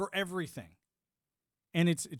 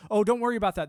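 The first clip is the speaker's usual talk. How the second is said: mock official; 6 semitones higher, 8 dB louder, faster than usual. Mocking the government's dismissive line.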